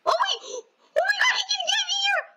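high frightened shriek; sudden real panic, crying out; panicked scream. Real, not acted. Monster can reach her in game.

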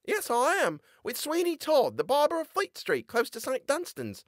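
put-on high boyish voice; a lively lad answering; young boy character voice; Tobias, boyish voice. A narrator's reading.

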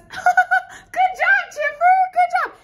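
high, loud laughing good job; host praising the puppet; laughing praise. Acted. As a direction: cheer the puppet on.

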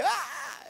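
high-pitched, drawn-out mock scream; hammed-up yell of fright; imitated group scream. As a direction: scream like startled boat passengers. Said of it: "todos gritaban ahí".

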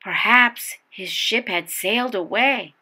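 ordinary delivery, nothing marked

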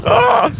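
sharp high yelp from cold water; cold shock yelp. Real, not acted. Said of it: "mi będzie zimno".

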